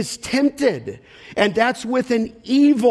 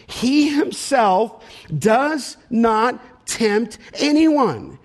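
slow, word by word, lifting on the last; emphatic verse reading; much slower than usual. Real, not acted. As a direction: set each word apart for weight. Stresses key clause of verse.

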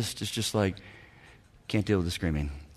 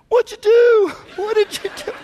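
much higher, loud astonished cry; amazed; 6 dB louder than usual. Retold or imitated. Pastor amazed at couple's counseling success. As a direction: gasp it out in disbelief. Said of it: "I'm like".

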